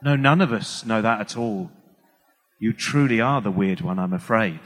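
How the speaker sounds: low, deadpan mock reply; audience verdict. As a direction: pronounce the verdict dryly. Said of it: "the whole audience go".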